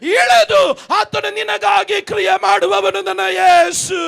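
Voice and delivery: fiery pastor exhorting the crowd; full-force preaching. Acted. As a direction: thunder it to the crowd.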